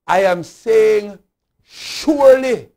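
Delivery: "surely" drawn out high and loud; slow, loud insistence, rising to a long "surely"; emphatic declaration. Real, not acted. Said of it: "I am saying emphatically".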